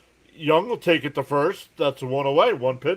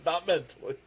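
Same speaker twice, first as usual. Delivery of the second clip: amused, higher voice breaking into laughter; laughing; 5 semitones higher than usual. Real, not acted. Joking to viewers about growing up, laughing. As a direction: say it through real giggles. Cracking up at own joke.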